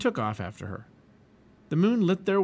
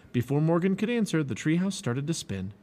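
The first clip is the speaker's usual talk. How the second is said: usual speaking voice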